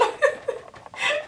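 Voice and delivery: a breathy high-pitched giggle at her own joke. Real, not acted. Giggling at the meme she just read to viewers. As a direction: burst into a high airy laugh.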